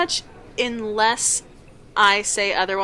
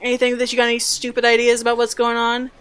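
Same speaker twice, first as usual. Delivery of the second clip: loud, fast, higher challenge; raised-voice, rapid demand; confrontational; 4 semitones higher, 6 dB louder, much faster than usual. Acted. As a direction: put someone on the spot in a rush. Giant confronts the rat guy.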